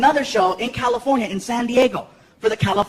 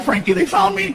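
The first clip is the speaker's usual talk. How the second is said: higher hammed-up panic; mock panic; 7 semitones higher than usual. Dad faking panic to fool son, higher. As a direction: pretend the cops caught you.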